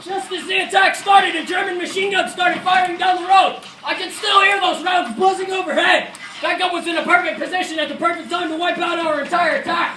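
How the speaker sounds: loud, high-pitched combat storytelling; shouted battle account. Acted. Soldier recalling machine gun fire on the road, shouting. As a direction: tell it as if over gunfire.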